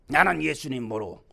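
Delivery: low, dropped voice disowning someone; disowning denial. Acted. Preacher voices Peter denying Jesus, low disowning voice. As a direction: deny it in a low undertone.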